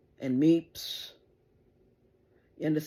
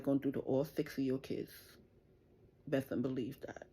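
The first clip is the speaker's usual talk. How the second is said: quiet threat; 9 dB quieter than usual. Real, not acted. Mother to her son's enemies, softly menacing. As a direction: let it sink in, cold and quiet.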